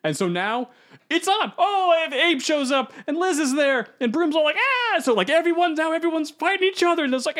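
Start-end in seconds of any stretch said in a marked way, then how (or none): 4.6-5.0 very high yelp; startled yelp. Retold or imitated. Old man startled as the fight breaks out, sharp yelp. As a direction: let out a sudden shriek.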